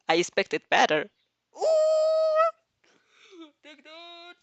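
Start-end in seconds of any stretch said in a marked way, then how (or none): 1.6-4.3 high, squealing delight; gleeful cheer. Real, not acted. Reader cheering the heroine's comeback, gleeful.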